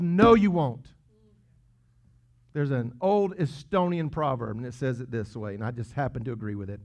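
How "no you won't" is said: loud, punched "No, you won't" with a falling end; preacher flatly refuting the grumbler, loud; emphatic no. Acted. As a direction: slam the door on the excuse. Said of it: "repeated retort, now loud".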